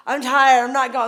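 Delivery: put-on loud, groggy drunk refusal; drunk husband. Wife imitating her drunk husband refusing to leave, loud and slurry. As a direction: whine it out like a drunk who won't budge. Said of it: "In his drunkard state".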